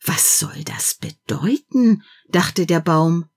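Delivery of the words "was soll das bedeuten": slow, hushed whisper. A narrator's reading. The tree alone in the attic, whispered. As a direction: whisper the question in bewilderment.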